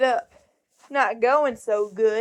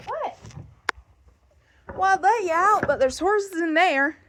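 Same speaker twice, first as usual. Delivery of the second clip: pet talk; 6 semitones higher than usual. Real, not acted. Talking to a cat.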